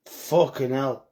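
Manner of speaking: normal talking voice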